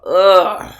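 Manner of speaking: loud grossed-out groan; a loud, disgusted groan; disgusted ugh. Real, not acted. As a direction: recoil at the thought. Dog got fleas, disgusted.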